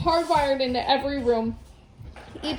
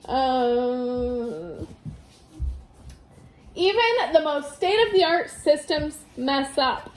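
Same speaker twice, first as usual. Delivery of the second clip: plain reading voice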